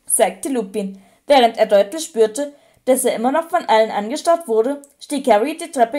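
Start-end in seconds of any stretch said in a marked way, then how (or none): none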